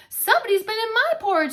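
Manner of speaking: Mama Bear's outraged complaint. Acted. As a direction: say it indignantly as Mama. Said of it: "said Mama Bear".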